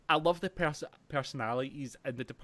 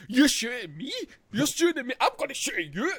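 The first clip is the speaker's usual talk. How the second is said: higher and louder, outraged gangster squeal; mock outraged yell; 11 semitones higher, 7 dB louder than usual. Friend mocking film gangsters, high outraged yell. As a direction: play the hothead yelling back at a shooter.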